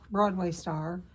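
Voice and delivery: normal talking voice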